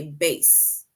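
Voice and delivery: "bass" with a long A, like case; "bass" pronounced like base; bass rhyming with case. Shown as a demonstration. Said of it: "it basically rhymes with case".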